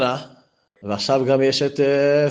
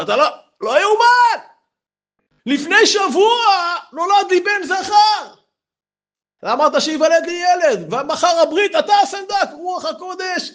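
ecstatic shout. Acted. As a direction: cry out the news of a son. Rich man announces miraculous son's birth.

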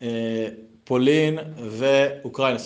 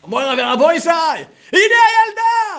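ecstatic shout; 8 dB louder than usual. Acted. Father announcing the revived girl, ecstatic high shout. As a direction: cry out in triumph, very high.

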